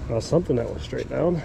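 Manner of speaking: normal talking voice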